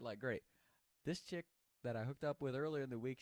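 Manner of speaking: ordinary delivery, nothing marked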